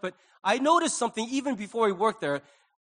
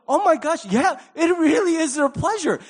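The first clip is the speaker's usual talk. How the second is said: loud, high amazed realization; astonished; 9 semitones higher, 9 dB louder than usual. Retold or imitated. Customer looks at the workers' faces, loudly astonished. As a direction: exclaim it in hammed-up wonder.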